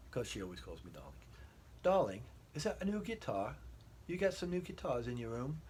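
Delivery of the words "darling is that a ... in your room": higher, lightly English wife voice; English accent. Retold or imitated. Wife asking about the new guitars in a light English accent. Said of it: "I'll try to use an English accent".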